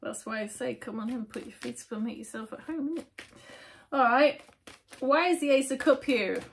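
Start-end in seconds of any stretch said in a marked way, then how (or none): 3.9-6.4 loud, high upbeat exclamation; energetic host reset; excited. Real, not acted. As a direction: burst back in with energy.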